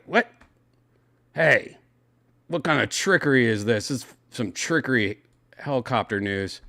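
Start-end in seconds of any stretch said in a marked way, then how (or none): none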